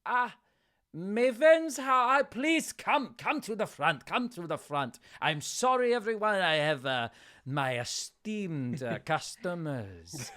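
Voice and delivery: put-on effusive, high accented showman; Etienne Beauregard. Fishmonger calling favourite customers forward, effusive showman. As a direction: gush a welcome, then ease down. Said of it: "he sees you and he goes".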